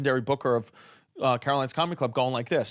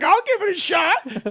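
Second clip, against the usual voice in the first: volunteer voice; 4 dB louder than usual. Retold or imitated. Audience member volunteering.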